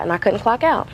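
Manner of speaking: ordinary talk, nothing marked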